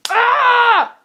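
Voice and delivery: high, loud cry of exasperation; frustrated scream. Real, not acted. Angry rant about homework.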